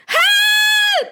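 piercing high scream; a shrill distant cry for help; shrilly shout. A narrator's reading. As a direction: shriek from far away. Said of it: "there was a shrilly shout".

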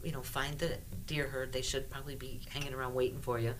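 guided meditation. Acted. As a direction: quietly lead them through the journey. Guides the listeners' spirit journey.

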